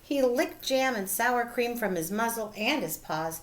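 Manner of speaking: plain reading voice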